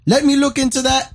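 high, mock Indian accent; hammed-up Indian customer service voice; imitating Indian call agent. Caller mimics the polite agent, in a mock Indian accent. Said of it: "And he goes".

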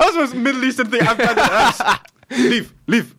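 high, squealing through laughter; real laughter breaking up the words; laughing exclamation. Real, not acted. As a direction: say it high while cracking up. Laughing at dad's interruption.

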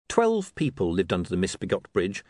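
usual speaking voice

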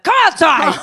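high, loud call to come outside. Retold or imitated. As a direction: yell it up from the street. Friends calling her out.

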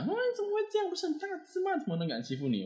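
imitating mom. Mother hearing her son was bullied, high falsetto disbelief. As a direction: squeak out a startled mother's disbelief.

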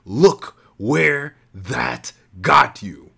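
villain slamming the hero into a wall, shouting slowly; shouted. A narrator's reading. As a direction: drag every word out in rage. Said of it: "he shouted".

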